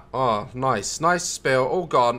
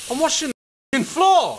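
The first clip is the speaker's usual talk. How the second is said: exclaimed; 4 semitones higher than usual. Real, not acted. Repeated louder exclamation.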